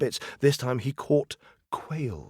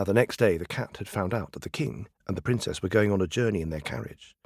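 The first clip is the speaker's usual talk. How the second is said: usual speaking voice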